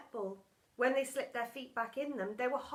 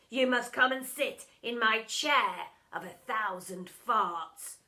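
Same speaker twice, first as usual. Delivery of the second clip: gloating teacher; 8 dB louder than usual. A narrator's reading. Doctor Dredd punishing Bog, loud gloating villain. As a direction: pronounce the sentence with relish.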